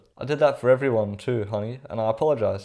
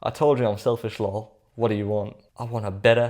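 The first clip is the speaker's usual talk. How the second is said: ordinary talk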